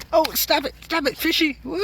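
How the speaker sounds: playful high voice pleading with a fish; high-pitched fish scolding. Real, not acted. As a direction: coo it high, like scolding a pet.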